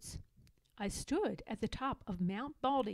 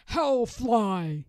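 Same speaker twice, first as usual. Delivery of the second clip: loud gruff troll question; troll roar; 7 dB louder than usual. Acted. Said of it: "the trolls roared".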